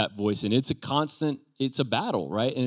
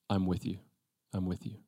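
much softer, lower, tender murmur; soft reassurance; 4 semitones lower, 10 dB quieter than usual. Retold or imitated. Preacher voices God comforting a fearful believer, very soft. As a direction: hush it like comforting a scared child.

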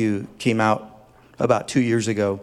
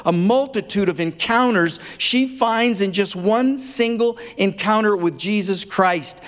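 higher, building preacher's fervour; impassioned preaching; 7 semitones higher than usual. Acted. Pastor to congregation on the sinful woman, rising preacher's fervour. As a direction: preach it up, climbing with passion.

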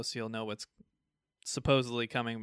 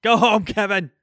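loud, high heckling yell; mock shout; 7 semitones higher, 11 dB louder than usual.

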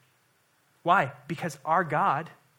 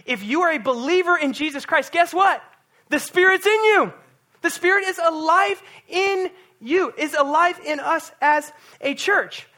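louder and higher, building to a preaching peak; rising preaching shout; 11 semitones higher, 8 dB louder than usual. Real, not acted. Pastor preaching to the church, building to a loud peak.

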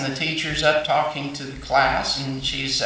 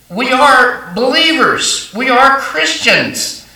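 "are" drawn out higher and louder, slow; stress on ARE; 9 semitones higher, 6 dB louder, slower than usual. Acted. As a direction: lean hard on "are" in both lines. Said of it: "preacher emphasizes "are".

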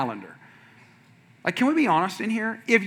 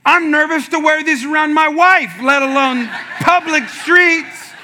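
higher, louder, laughing through the words; laughing protest; 10 semitones higher, 6 dB louder, slower than usual. Retold or imitated. Pastor telling the church about wearing the shorts, laughing. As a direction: howl the complaint while cracking up.

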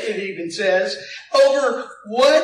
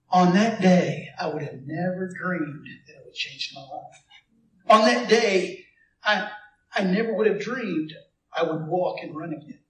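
heartfelt testimony; 4 semitones lower than usual. Pretend. Healed man testifying on the radio, low and tender. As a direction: tell it like a grateful healed man.

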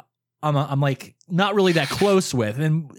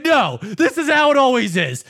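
high, loud imitated exasperation; retold angry yell; 7 semitones higher, 5 dB louder than usual. Brother refusing to calm down, retold angry yell. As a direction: act out a fed-up brother. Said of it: "you called to yell at me".

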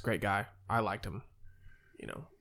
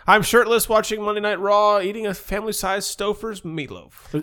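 mock status post; 7 semitones higher, 6 dB louder than usual. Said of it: "your Facebook statuses".

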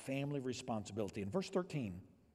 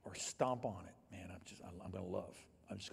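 whisper; 6 semitones lower, 7 dB quieter than usual. Acted. Pastor voicing love that is given anyway, whispered. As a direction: let it fall to a whisper. Loving whatever the response.

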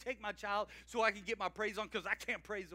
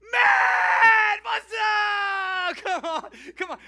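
much higher, very loud excited shout; enthusiastic greeting; 13 semitones higher, 19 dB louder, much slower than usual. Shown as a demonstration. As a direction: greet them like a thrilled kid. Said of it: "What if we started doing that?".

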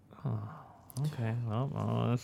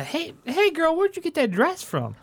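higher, excited gossip; gushing girl talk; 3 dB louder than usual. Acted. Player gushing to the gnome about her dress. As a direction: gush over her dress.

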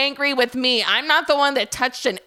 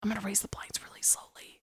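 very soft, hushed whisper; cautious whisper; 5 semitones lower, 16 dB quieter, faster than usual. Acted. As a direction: whisper your careful next move. Whispering while peeking out.

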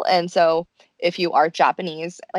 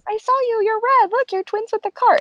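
much higher, delighted squeal; squealing girl. Retold or imitated. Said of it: "Haruka goes".

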